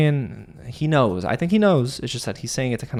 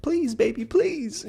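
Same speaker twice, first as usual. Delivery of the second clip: mock begging; 14 semitones higher, 3 dB quieter, slower than usual. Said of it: "practically begging for Annie".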